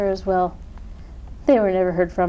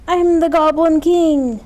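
high, loud boast as the Goblin King; proud proclamation; 7 semitones higher, 7 dB louder than usual. A narrator's reading. Goblin shows off the old crown, proud high proclamation. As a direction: crow it out grandly.

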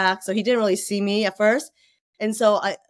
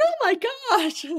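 mock shock. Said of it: "I'm like".

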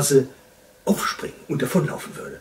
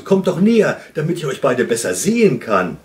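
calling out; 3 semitones higher, 9 dB louder, faster than usual. A narrator's reading. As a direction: call them over from a distance. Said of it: "rief der Wunschhase".

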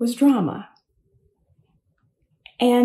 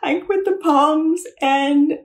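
put-on higher, louder, tearful whine of a hurt teenager; retold upset wail; 5 semitones higher, 5 dB louder than usual. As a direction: replay the hurt kid pleading her case, louder. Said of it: "I was just bawling".

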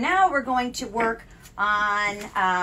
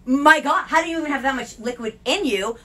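retold disbelief; 6 semitones higher, 8 dB louder than usual. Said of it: "I was like".

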